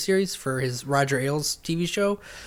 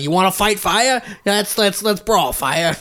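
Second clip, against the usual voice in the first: South Park Crowe; 5 semitones higher, slower than usual. Retold or imitated. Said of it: "The South Park parody of him".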